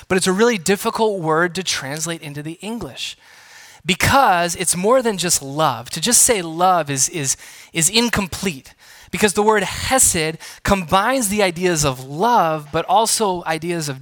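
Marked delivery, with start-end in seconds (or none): none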